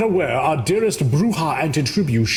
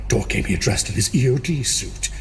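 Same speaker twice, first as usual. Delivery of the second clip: ordinary talk, nothing marked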